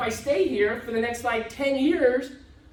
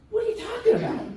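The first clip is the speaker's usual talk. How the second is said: high, laughing disbelief; a high laughing reply to a friend giving up; disbelieving laugh; 11 semitones higher, faster than usual. Retold or imitated. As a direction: scoff at the idea, laughing. Retold disbelief at Jordan.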